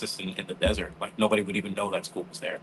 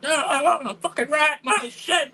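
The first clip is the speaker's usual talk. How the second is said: high, loud mock fury; furious retold demand; 5 dB louder than usual. Enraged teen refusing the nurse, high mock fury. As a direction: demand the rat back, way up high. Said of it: "She's like".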